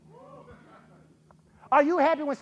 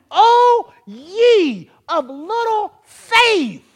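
higher, louder, slowly stretched rebuke; bold rebuke; 8 semitones higher, 10 dB louder, much slower than usual. Shown as a demonstration. Preacher showing how Jesus really said it, loud and drawn out. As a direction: proclaim it boldly over the storm. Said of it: "He didn't say it like that".

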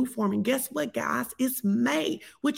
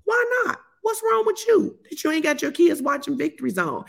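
mock scolding; 8 semitones higher than usual. Playful scolding of parents.

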